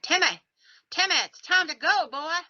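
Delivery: high-pitched imitated Southern holler; thick Southern yell. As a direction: call out in a thick Southern accent. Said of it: "he yells in his thick accent".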